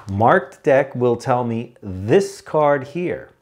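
slow, emphatic word-by-word explanation; measured pace, each key word leaned on; deliberately slow. Shown as a demonstration. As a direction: spell it out slowly as if for slow learners. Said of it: "Am I talking slow enough?".

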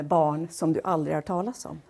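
usual speaking voice

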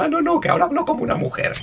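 high, hurried put-on woman's voice; mock flustered switch to feminine voice; como una mujer. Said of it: "empezando a hablar como un hombre y cambia". (voz aguda imitando a una mujer)

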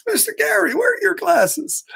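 put-on very high-pitched little girl voice; imitating young child. Imitating Rose seeing her teacher without glasses, high child voice. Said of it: "she was like".

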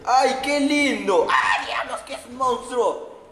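a player reacting to a cute creature becoming a monster, high drawn-out mock shock; mock shocked exclamation. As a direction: coo at it, then recoil in mock horror. Reacting to cute thing turning monster.

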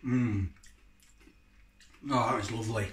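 talking while chewing; chewing food. Real, not acted. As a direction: mouth partly full. Seen 0: took chilli bite around -4s; jaw working, lips closed while murmuring.

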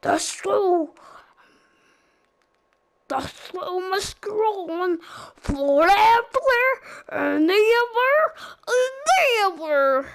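cheerful cartoon leader voice; Fleagle voice. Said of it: "let's do Fleagle first".

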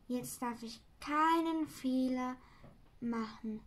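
ordinary delivery, nothing marked

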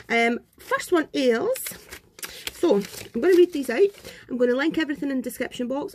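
normal talking voice

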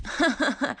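performed ha-ha-ha laugh. A narrator's reading. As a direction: laugh it off in character. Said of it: "розсміявся Сем".

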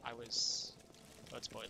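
normal talking voice

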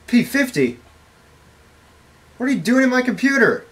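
usual speaking voice

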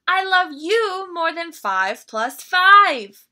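high, loud and slow triumphant shout. Acted. Little bear outdoes Mama's counting, shouting loud and slow. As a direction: belt it out like a proud little cub. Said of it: "and he shouted".

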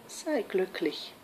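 slow, hushed good wish; quiet, tender benediction; soft blessing. Acted. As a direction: say it gently, drawn out. Eros blesses lovers at his victory.

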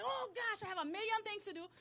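high-pitched, mildly panicked "oh gosh"; flustered exclamation. Retold or imitated. Acting own waking panic.

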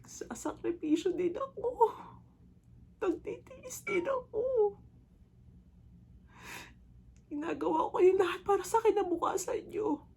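high, voice breaking into crying; tearful pleading. Acted. Mother tells her children she sacrifices too, tearful pleading. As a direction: plead through tears, pitch climbing.

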